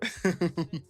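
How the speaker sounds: real laugh; amused laugh. Real, not acted. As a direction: laugh at your own joke. Laughing at own Common Core joke.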